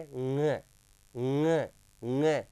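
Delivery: ng repeated as a held nasal nga; correct pronunciation demo. Shown as a demonstration. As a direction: drill ng from the nose, each one apart. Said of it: "इसका उच्चारण कैसे करेंगे?". (ng को नाक से "ङ" कहकर दोहराएँ)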